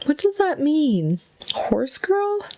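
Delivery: high, puzzled question; confused high squeak; baffled. Real, not acted. Baffled by the horse girl question.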